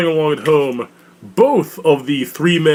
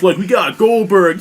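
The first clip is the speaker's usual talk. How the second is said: normal talking voice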